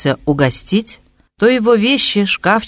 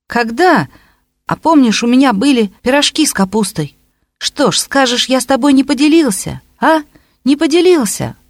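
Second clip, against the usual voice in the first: indignant; 8 semitones higher than usual. A narrator's reading. Fetchenko reproaches high and loud.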